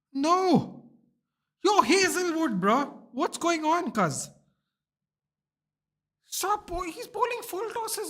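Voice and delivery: high, exasperated yelling at the bowler; excited scolding. Real, not acted. Fan scolds Hazelwood on screen, high exasperated yell. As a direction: heckle the bowler in disbelief.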